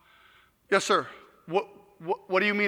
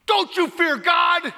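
screaming back; 8 semitones higher, much slower than usual. Retold or imitated. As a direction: shout him down, slow and fierce on fear God. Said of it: "I screamed back at him".

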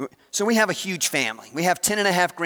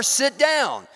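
scolding; 8 semitones higher than usual. Acted. Scribe scolds son mid-copying.

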